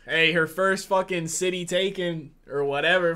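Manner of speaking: normal talking voice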